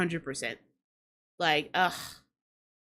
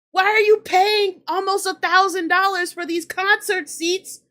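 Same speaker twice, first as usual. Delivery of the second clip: higher and louder, furious yelling; pissed rant; 4 semitones higher, 3 dB louder than usual. Real, not acted. Vlogger ranting at a bored concertgoer, furious shouting. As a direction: lose it at the crowd. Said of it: "I'm pissed".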